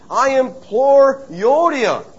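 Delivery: slow, high, heavy implore; solemn, slow. Acted. Elder reading her name aloud, slow and solemn. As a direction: call her out by name, slowly.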